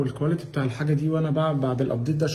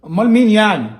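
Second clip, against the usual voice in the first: loud, higher-pitched exasperated question; irritated demand; 4 semitones higher, 7 dB louder than usual. Real, not acted. Husband challenging wife over who touched it, irritated. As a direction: demand an answer, voice raised.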